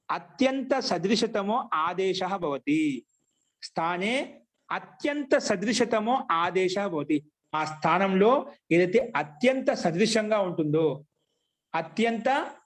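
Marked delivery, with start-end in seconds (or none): 0.1-7.3 slow, measured Sanskrit recitation; sutra recitation. Shown as a demonstration. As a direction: recite the rule slowly, phrase by phrase. Recites the rule for students.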